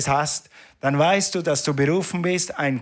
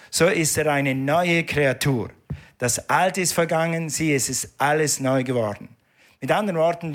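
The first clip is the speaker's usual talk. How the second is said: usual speaking voice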